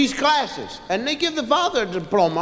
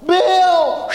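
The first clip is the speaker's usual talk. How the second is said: loud, higher call of his name; 6 semitones higher, 5 dB louder than usual. Retold or imitated. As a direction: yell his name from the balcony. Wife calling from balcony.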